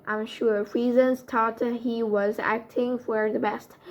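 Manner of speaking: usual speaking voice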